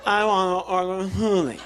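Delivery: loud, garbled imitation of the server; put-on carny garble, pushed loud; mock carny talk. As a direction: answer in exaggerated counter-speak, slurring the words together. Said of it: "Beating at my own game".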